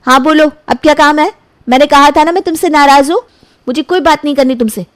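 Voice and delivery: lightly voiced girlfriend still cross; annoyed girlfriend. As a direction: girlfriend brushing off her boyfriend, mildly annoyed.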